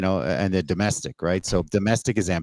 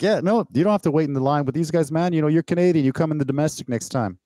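higher-pitched, easygoing officer voice; imitating a relaxed, friendly border agent, pitched up; friendly customs officer; 7 semitones higher than usual. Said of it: "He's just like".

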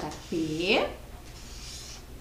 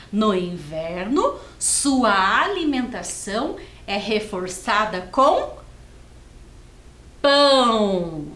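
put-on slow, lively storybook read for kids; reading to children; 4 dB louder, slower than usual. Teacher reads the Bob text slowly to young pupils. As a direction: read it out slowly and brightly to the class.

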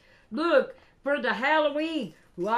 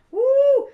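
loud, high "woo" of delight; real overjoyed holler; excited whoop; 13 semitones higher, 13 dB louder than usual. Real, not acted. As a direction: let out a sudden piercing whoop. Thrilled by a full package.

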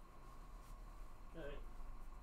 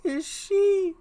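high, put-on clown voice; high and drawn-out comic figure; trial clown voice; 4 dB louder than usual. Shown as a demonstration. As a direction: try out a high-pitched character voice. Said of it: "what kind of voice should I give him?".

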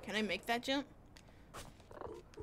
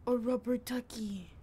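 slow, savoured exclamation of delight; 5 dB louder than usual. Real, not acted. Player spotting a toy in the water, delighted. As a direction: draw it out with mild wonder.

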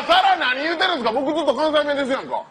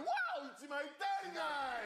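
明るく叫ぶ幽霊; 楽しげに声を張る; 明るすぎる幽霊; 3 semitones higher, 20 dB quieter than usual. Acted. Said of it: "明るすぎんだろ". (明るすぎる幽霊の叫び)

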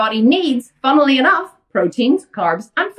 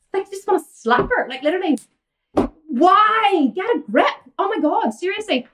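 exasperated; 7 semitones higher than usual. Real, not acted. Exasperated at dieting client.

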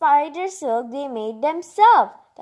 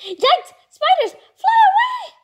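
a much higher, squeaky bug voice crying out; scared bug; 5 dB louder than usual. Acted. Frightened bugs flee from the farmer's spiders, squeaky alarm. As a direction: shriek like a tiny panicked insect.

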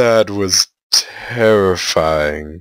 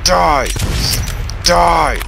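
yelling at the enemy; 10 semitones higher than usual. Real, not acted. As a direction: shout it at the monster like you mean it. Gamer fighting an invincible enemy.

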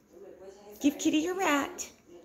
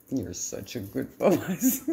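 ordinary talk

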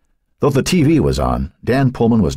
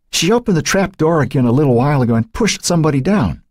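put-on frightened girl; 11 semitones higher than usual. A narrator's reading. Kelly explains the trap door.

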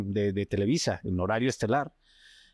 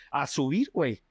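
ordinary talk

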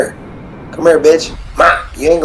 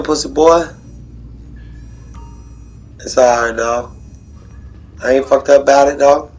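normal talking voice

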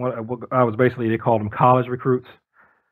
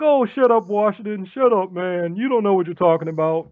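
mocking dismissal; 6 semitones higher than usual. Guys dismissing speaker.

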